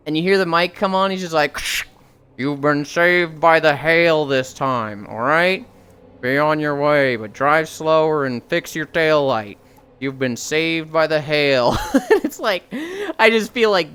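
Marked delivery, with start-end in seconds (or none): 2.4-9.6 deep slow cop on the loudspeaker; mock cop voice. Cop on the car mic. 10.0-11.8 slow deep cop, one more time; mock cop voice. Cop line repeated.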